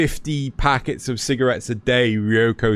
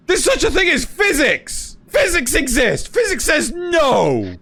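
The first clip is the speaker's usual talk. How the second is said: much higher, shouted protest; indignant shout; 12 semitones higher than usual. Real, not acted. Ranting to friends that physics exists, shouting. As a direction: yell it like the last word. Ranting against supernatural plot logic.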